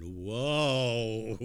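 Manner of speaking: mock drunken whoa. Lawyer called to the bench by the judge, hammed-up tipsy whoa. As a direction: draw it out, rising then falling. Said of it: "And I went".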